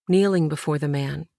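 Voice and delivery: usual speaking voice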